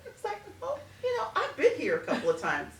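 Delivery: amused at her own experience; chuckling while explaining; laughing. Real, not acted.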